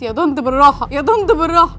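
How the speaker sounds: pleading. Retold or imitated. Pleading with aunt putting earring.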